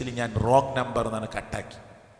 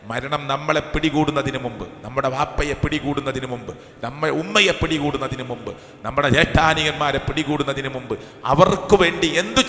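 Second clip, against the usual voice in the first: rising sermon fervour; 3 semitones higher, 6 dB louder than usual. Acted. Preacher warning the congregation that death comes suddenly, fervent and rising. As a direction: urge the congregation, building in intensity.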